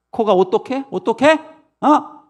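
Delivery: high, rising challenge; acted child's querulous protest; argumentative child. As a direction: press mother for an answer. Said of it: "계속 그 엄마한테 따집니다".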